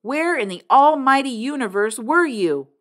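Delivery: loud, high furious demand; exasperated yell at the kid; shouting. A narrator's reading. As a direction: blast the question at him. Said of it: "His voice was rising".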